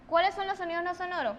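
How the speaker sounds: ordinary talk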